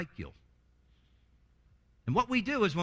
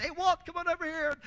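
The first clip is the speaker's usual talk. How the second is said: high, friendly call across the office; cheery colleague call; 10 semitones higher than usual. Retold or imitated. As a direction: call out to Walt like a chummy coworker. Coworkers calling him over cheerfully.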